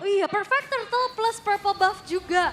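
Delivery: loud high hype call; esports caster. Real, not acted. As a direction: ride the excitement, loud and high. Hyped objective call.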